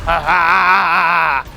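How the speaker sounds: low gloating villain laugh; evil laugh. Acted. Monster gloating over the city, low evil laugh. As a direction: laugh like a monster pleased with the damage.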